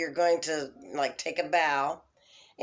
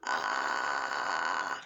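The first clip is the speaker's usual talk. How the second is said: deep crackling fry on ah; vocal fry; 14 semitones lower than usual. Retold or imitated. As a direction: let the ah crackle low and loose. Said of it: "Maxine pretty much did".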